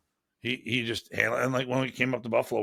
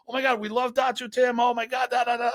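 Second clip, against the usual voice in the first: much higher, breathless fan excitement; gushing fan; 12 semitones higher than usual. Retold or imitated. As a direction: rave like an over-the-moon admirer. Fans gushing about Datu Tim.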